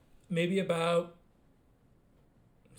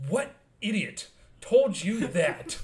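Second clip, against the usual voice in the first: mock indignant; 6 semitones higher, 6 dB louder, much slower than usual. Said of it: "I was like".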